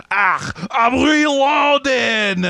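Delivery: loud, high, slow hammed-up pirate shout; awful pirate accent. Quoting a player's awful pirate accent, loud and hammy. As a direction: bellow like a cartoon pirate. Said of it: "hamming it up with this awful accent".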